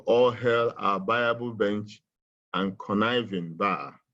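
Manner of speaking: "All hail" slow and weighty, then falling; emphasized repeat. Shown as a demonstration. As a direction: stretch out "All hail our viable bench" for weight. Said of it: "I like to emphasize that also".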